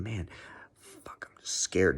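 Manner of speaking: hushed, slowed whisper of nerves; scared whisper. Retold or imitated. Whispering his fear to himself before the approach.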